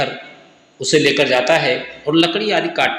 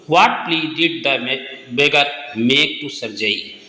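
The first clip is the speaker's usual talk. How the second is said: slow dictation; 3 semitones lower, much slower than usual. Shown as a demonstration. Teacher reads question slowly.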